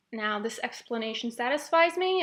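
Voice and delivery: usual speaking voice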